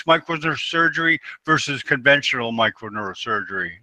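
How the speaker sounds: ordinary talk, nothing marked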